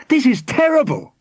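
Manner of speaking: very high-pitched dismay; distressed cry. A narrator's reading. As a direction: cry out in alarm. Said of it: "cried Formby".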